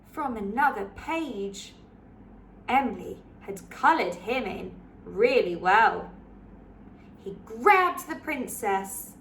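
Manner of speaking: put-on lively read-aloud for children; story narration. A narrator's reading. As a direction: read it like a bedtime picture book. Picture book read aloud.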